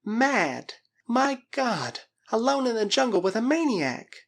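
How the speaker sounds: high, despairing outburst; horrified. Acted. Girl alone with a maniac, high horrified voice.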